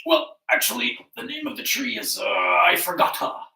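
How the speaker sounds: bluster, then blurt out the failure; blustering confession. Acted. Lion admits forgetting to the animals, loud and blustering.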